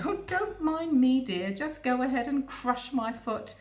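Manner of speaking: high, slow, dripping sarcasm; mock-sweet, slow and sarcastic. Commuter needles the foot-stander, slow and sarcastic. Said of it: "very sarcastic and say".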